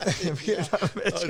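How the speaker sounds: slow, amused repeat through a laugh; teasing his old friend, slowly laughing out Vietnam; laughing retake. Shown as a demonstration. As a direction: lean on Vietnam, drawn out and chuckling.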